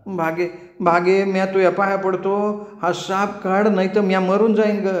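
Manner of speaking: mild frightened plea; terrified begging. A narrator's reading. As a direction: beg her to take the snake off. Terrified boy begs.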